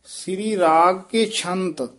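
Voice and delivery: slow phrase closing on ਛੰਤ; brief halt after ਛੰਤ. Shown as a demonstration. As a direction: draw it out, then stop at ਛੰਤ. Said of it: "ਇੱਥੇ ਠਮਕ ਲਾ ਦੇਣੀ ਹੈ".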